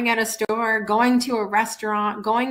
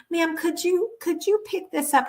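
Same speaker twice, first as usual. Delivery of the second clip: put-on higher, sweet old woman's voice asking a favour; sweet old lady; 9 semitones higher than usual. Old lady asks the delivery woman for help, sweetly pleading. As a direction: play the gentle elderly neighbour asking politely. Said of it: "And she's like".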